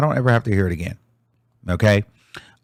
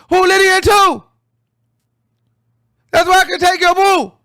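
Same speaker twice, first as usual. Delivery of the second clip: much higher shouted mock rap, fast and loud; 5 dB louder than usual. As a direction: yell the verse in a squeaky imitation of the rapper. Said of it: "she's yelling it".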